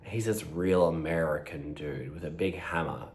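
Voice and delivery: usual speaking voice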